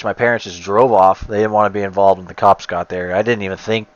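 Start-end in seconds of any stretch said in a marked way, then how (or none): none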